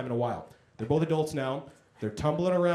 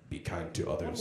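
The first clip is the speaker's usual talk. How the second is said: mock inspirational exit; 10 semitones lower, 8 dB quieter than usual.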